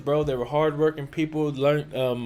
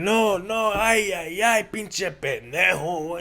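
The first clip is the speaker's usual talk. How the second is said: higher, put-on old grandma scolding in Spanglish; mock scolding grandma; 4 semitones higher, slower than usual. Grandson mimicking his grandma's Spanglish cussing, mock scold. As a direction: imitate grandma cussing him out, slowing at the end. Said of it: "she's like".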